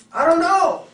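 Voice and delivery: high, loud, blank "I don't know"; high, loud, clueless answer to a best friend; clueless buyer. Acted. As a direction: play the buyer who has no idea.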